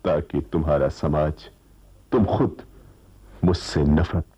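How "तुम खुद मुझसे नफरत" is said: ordinary talk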